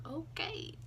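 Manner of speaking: high, soft, drawn-up question; puzzled okay. Retold or imitated. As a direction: let it rise high and quiet, baffled. Retold puzzled reaction to being ignored.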